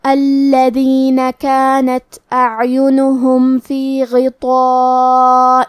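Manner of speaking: very slow chanted tajweed recitation; slow melodic Quranic recitation. Acted. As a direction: recite the verses slowly with long drawn vowels. Teacher recites verses for tajweed lesson.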